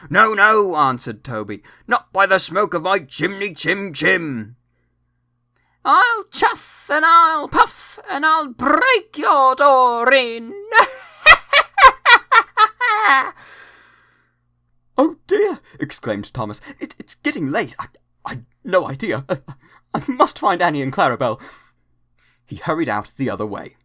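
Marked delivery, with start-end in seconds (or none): 0.1-10.5 higher and louder, hammed-up nursery-rhyme threat; mock wolf play. Percy and Toby playing the wolf game, hammed-up threat. 10.6-13.3 much higher and louder falling spooky cackle; ghostly laugh. Acted. Percy haunting Thomas, spooky cackle. 15.0-21.4 flustered. Acted. Thomas flustered, stammering excuses.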